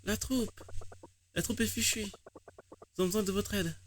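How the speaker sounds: high, pleading character voice; high anxious game character; worried character. Acted. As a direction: beg the heroes for help.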